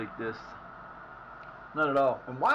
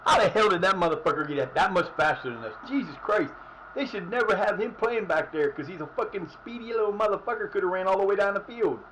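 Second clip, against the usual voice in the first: loud, heated rant, pitch pushed high; frustrated rant; 6 semitones higher, 4 dB louder than usual. Real, not acted. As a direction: vent at the screen in real frustration. Raging at opponent's speed.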